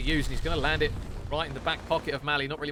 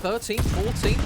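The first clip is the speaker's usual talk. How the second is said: excited; 7 semitones higher, 7 dB louder, slower than usual. Real, not acted. Caster hyped by combined streak.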